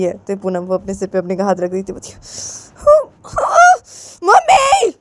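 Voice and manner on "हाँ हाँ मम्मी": very high, loud yelp; shocked cry. Acted. Daughter just hit on the head by mummy, shocked cry. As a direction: cry out in hurt protest.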